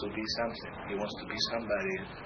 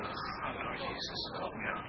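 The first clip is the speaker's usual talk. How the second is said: breathy whisper, pleading to the Lord; whispered prayer; 9 dB quieter, slower than usual. Retold or imitated. Retelling his own plea to Jesus, whispered. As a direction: whisper it like a quick desperate prayer. Praying for help when overwhelmed.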